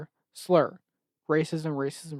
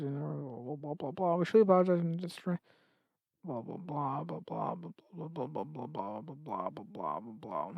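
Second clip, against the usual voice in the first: dismissive mumble; 8 dB quieter than usual. Real, not acted. Skimming the statement dismissively.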